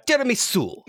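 showy Italian tiramisu; mock Italian accent. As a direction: land the dessert in hammed-up Italian. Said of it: "Italian words with an Eng".

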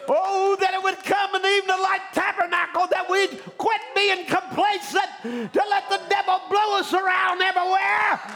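high, loud indignant exhortation; fervent preaching shout. Real, not acted. Preacher rebuking those who let the devil wreck homes, fervent shout. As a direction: shout it out to rouse a complacent church.

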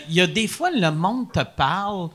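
ordinary delivery

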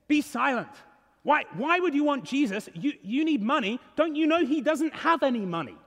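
high, scolding put-down; rebuking crowd. Acted. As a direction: scold the beggar into silence, rising to a curt order. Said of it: "But the crowd rebuke him".

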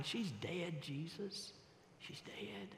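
the mourners murmur that she's dead; mourners' hushed wail. Acted. Preacher voicing mourners, hushed and grieving.

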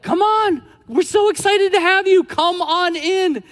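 high, loud eager welcome; excited welcome. Retold or imitated. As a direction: call them in with glee, drawing out the last words. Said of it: "Mary and Martha's like".

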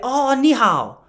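high-pitched imitated Chinese accent. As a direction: greet in a put-on Chinese accent. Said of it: "little Chinese kid in his accent".

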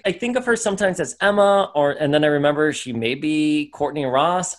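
usual speaking voice

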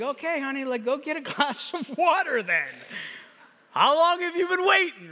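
high-pitched, playfully put-out parent; hammed-up weary indulgence, rising in pitch; mock exasperation. Woken parent answers the thirsty child, mock exasperation.

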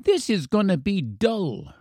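put-on high-pitched sulky boy; blurting little boy. A narrator's reading. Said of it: "blurted the little boy".

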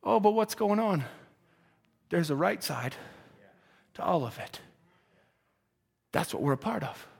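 low, soft, weighty preacher's aside; hushed preaching. Real, not acted. Preacher confiding soberly to the congregation, hushed and low. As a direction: drop right down low and quiet.

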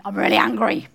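loud burst of pretend anger; mock angry. Speaker offering the congregation another meaning, mock angry outburst. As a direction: blurt it out loudly, playing at furious.

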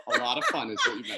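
high, laughing through the words. Real, not acted. Teasing the host about the party plan, laughing. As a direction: still laughing as you tease. Joking with friends.

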